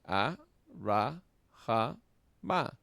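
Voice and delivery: a, ra, ja, ma said as separate beats; syllable by syllable. Shown as a demonstration. Teacher counting the syllables out for the class, slowly. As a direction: count out a, ra, ja, ma one by one. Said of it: "tenemos cuatro vocales".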